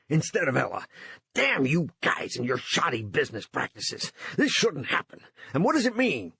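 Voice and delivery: panting, upset and out of breath; breathless and angry, pitch rising. A narrator's reading. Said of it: "Runciter panted".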